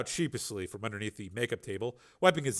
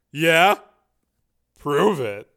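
ordinary delivery

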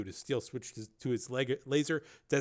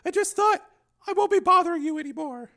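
high, hurt apology; tearful woman; 8 dB louder than usual. Acted. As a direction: apologise high, close to tears. Woman tearfully gives up, leaving.

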